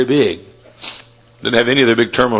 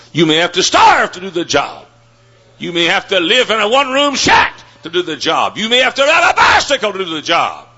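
preaching at full force; 12 semitones higher than usual. Acted. Preacher rousing the congregation to give more, in a climbing shout. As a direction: build the sermon to a ringing climax.

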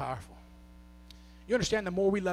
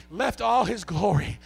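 builds from speech to loud, high hollering; preaching, building to shouts; 10 semitones higher, 17 dB louder than usual. Acted. Preacher rousing church to thank God, building to shouts. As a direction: climb until you're shouting praise.

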